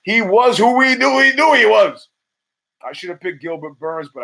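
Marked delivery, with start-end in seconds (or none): 0.0-2.1 high, loud mock rant; yelling imitation of a furious coach; Denny Green rant. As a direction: shout it like a coach flipping out. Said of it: "like Denny Green once said, flipping out".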